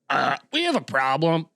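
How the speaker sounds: put-on awkward announcer voice; lightly voiced bar pianist addressing the room; piano player quote. Pianist to the bar crowd, put-on announcing voice. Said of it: "person playing the piano was like".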